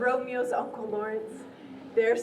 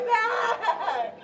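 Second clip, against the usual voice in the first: higher, laughing mock complaint; a playful higher moan over the back; hammed-up aching back; 7 semitones higher than usual. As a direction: ham up the sore back with a laugh.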